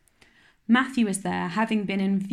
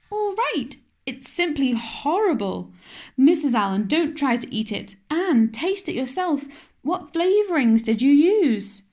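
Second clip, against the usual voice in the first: much higher, louder scolding alarm; horrified; 6 semitones higher, 5 dB louder than usual. Acted. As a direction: sound appalled and take charge. Marilla horrified at the cake.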